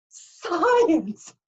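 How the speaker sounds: high, loud mocking exclamation; scoffing. A narrator's reading. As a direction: sneer the word out. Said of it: "Mister D scoffed".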